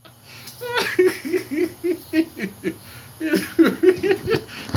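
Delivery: high, loud burst of real laughter. Real, not acted. Streamer reading a comment, bursting into loud laughter. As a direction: crack up at the joke in chat.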